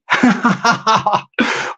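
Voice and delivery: amused chuckle at own joke; short run of real giggling; laugh. Real, not acted. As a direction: laugh off your own accent attempt.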